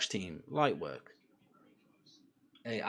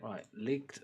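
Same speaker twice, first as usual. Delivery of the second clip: usual speaking voice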